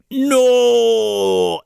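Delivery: sharp loud high "No!"; loud protest. Retold or imitated. Leprechaun hearing he's going to Lake Erie, loud high "No!". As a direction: cry out in refusal. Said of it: "he's like".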